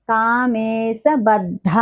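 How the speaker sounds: కామేశబద్ధ drawn out very slowly; slow syllable by syllable. Shown as a demonstration. Teacher showing students the aspirated ధ, slow and careful. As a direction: sound out కామేశబద్ధ one syllable at a time. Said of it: "ధ అన్నప్పుడు".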